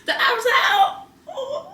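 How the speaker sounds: high, laughing through the words. Real, not acted. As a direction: crack up while saying it. Repeats it laughing.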